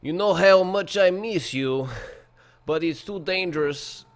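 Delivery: put-on high, loud hammy Russian accent; terrible Russian accent. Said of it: "my terrible, terrible Russian accent".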